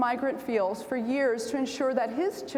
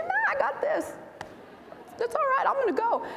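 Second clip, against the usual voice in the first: high, breezy brush-off, fast at the end; a student waving off her parents, high and breezy; cocky teen; 11 semitones higher than usual. Retold or imitated. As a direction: toss it off like an overconfident kid. Cocky young self to parents.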